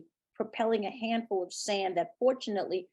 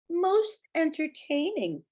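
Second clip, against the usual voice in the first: bright; 8 semitones higher, 3 dB louder, slower than usual. A narrator's reading. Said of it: "Harrison said brightly".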